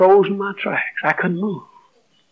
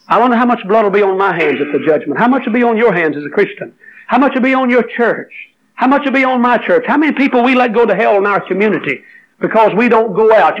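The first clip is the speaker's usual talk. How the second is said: building sermon; 4 semitones higher than usual. Acted. Preaching climbs in pitch.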